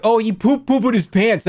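high-pitched teasing example line; put-on silly quote; mock silly example. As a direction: tease in a high, childish singsong. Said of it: "That's what he's gonna say".

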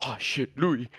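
low, softened voice for a scared friend; mock hushed fear. Friend hiding from supposed intruders, hushed mock fear. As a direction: imitate someone lying low upstairs.

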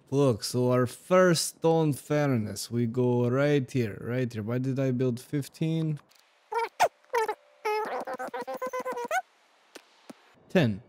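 hammed-up Russian accent for game commentary. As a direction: play a bossy Russian builder, thick accent. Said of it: "in a Russian accent".

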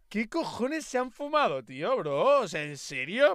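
queja incrédula que va subiendo; indignación. Real, not acted. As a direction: protesta cada vez más aguda, hasta casi gritar. Indignado por el doblaje de Carnage. (indignado de verdad, subiendo el tono)

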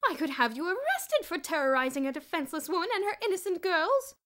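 high, very loud indignant accusation; outraged. A narrator's reading. Miss Scrimmage furiously accuses the headmaster of prowling. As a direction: accuse him with wounded fury.

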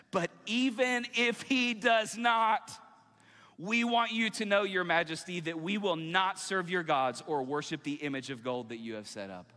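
loud, high, drawn-out opening easing as it goes; impassioned scripture reading. Acted. Preacher voicing the refusal to worship idols, impassioned. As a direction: proclaim defiance, then settle into resolve. Climax of the defiant reply.

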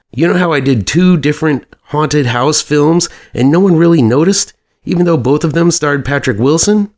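eager movie pitch. Acted. Producer pitching a film to his partner on the phone, eager and high. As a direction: pitch it high, ending on a question.

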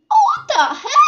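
very high, loud yelp of disbelief; startled squeal. Pretend. Player startled by what the game shows, high loud squeal. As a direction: shriek it in a squeaky voice.

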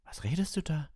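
put-on a boy caught off guard; young boy voice. A narrator's reading. Ron asks Hermione, puzzled boy's voice.

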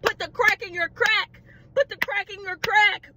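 high, emphatic retort to a friend; insistent mock command. What she should have told her friend, high and insistent.

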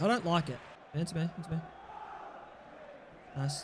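usual speaking voice

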